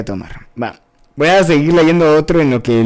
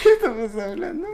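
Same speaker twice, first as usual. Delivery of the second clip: high, squeaky words through laughter; helpless laughing; 12 semitones higher, 4 dB quieter than usual. Real, not acted. Host overcome by the dirty talk, laughing helplessly. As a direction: barely get the question out for laughing.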